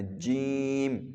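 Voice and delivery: جیم stretched very slowly; drawn-out jeem. Shown as a demonstration. Teacher showing a stretched جیم. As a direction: pull جیم out long. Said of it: "کھینچ سکتے ہیں".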